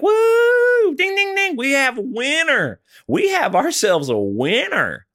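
very high mock whoop into drawn-out announcement; celebrating radio DJs. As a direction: parody a contest-winner fanfare. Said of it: "And they're like".

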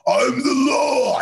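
Stallone's Judge Dredd. Acted. Co-host imitating Judge Dredd, slow and hammed-up. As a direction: bellow it slowly like Judge Dredd.

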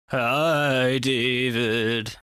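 slow eerie ghostly greeting; haunt the name like a ghost; spooky voice. Acted. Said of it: "that was so spooky".

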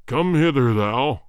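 deep, commanding summons; voice of thunder. Acted. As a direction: thunder it at the soldier. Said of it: "in a voice of thunder".

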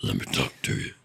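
very low rumbling voice; Sid's rumble. Retold or imitated. Host imitates deep-voiced Sid greeting Clive, very low rumble. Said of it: "He can rumble at me".

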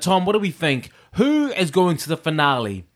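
ordinary talk

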